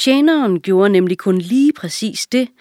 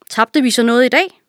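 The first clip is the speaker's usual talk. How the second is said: king voice; 5 semitones higher, 4 dB louder than usual. A narrator's reading. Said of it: "king asks servant".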